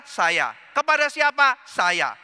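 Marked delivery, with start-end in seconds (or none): 0.7-1.6 high, loud rhetorical question; raised question. Shown as a demonstration. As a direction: ask it up and loud. Preacher acts self dialogue. (pertanyaan lantang dan tinggi)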